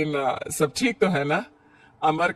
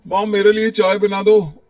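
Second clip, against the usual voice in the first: loud, higher call across the house; 4 semitones higher, 8 dB louder than usual. Acted. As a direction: shout for mom from another room. Son calling mother for tea.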